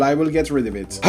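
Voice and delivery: ordinary delivery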